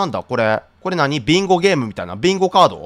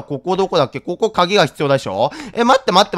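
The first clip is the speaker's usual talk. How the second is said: normal talking voice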